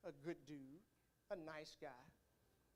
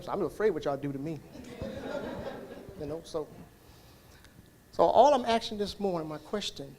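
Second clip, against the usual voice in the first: loud, driving sermon voice, louder; preaching at full force; 20 dB louder than usual. Acted. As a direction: preach it out to the church, full volume.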